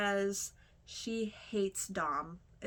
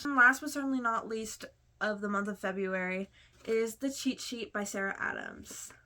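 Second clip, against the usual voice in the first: normal talking voice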